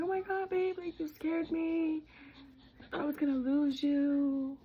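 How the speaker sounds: high, emotional imitation of crying husband; quoting husband. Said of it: "He's like".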